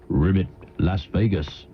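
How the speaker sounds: lightly voiced frog, low and slow; a slow, low-pitched hint of a frog; talking frog. Frog answers the golfer where to next, lightly voiced low. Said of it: "The frog says".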